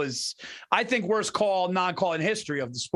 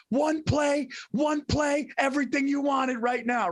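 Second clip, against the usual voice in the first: coach hyping; 7 semitones higher than usual. Retold or imitated. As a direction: fire the player up for one play. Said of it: "He goes".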